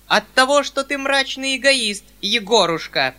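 very high, loud outburst; growing heated. A narrator's reading. General's wife berates her son, rising and loud. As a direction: lash out ever harder. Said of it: "всё более и более одушевляясь".

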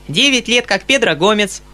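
put-on little boy reciting by rote, pitched high; high childish recitation; small, even, clear voice. A narrator's reading. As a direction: recite like a child, without punctuation. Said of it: "маленьким, ровным и ясным голосом".

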